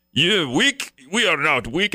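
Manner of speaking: badly faked Ukrainian accent, taking offence; attempted Ukrainian accent. Subway Ukrainian answering Kramer's insult, in an attempted Ukrainian accent. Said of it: "I can't do a Ukrainian accent".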